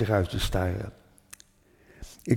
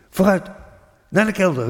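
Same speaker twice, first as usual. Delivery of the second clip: urgent, higher, louder call; retold shout; 8 semitones higher, 4 dB louder than usual. Father hurrying family to the cellar, urgent shout. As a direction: hurry everyone down like an alarmed father. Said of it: "riep hij".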